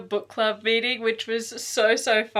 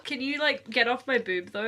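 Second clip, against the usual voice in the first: ordinary talk, nothing marked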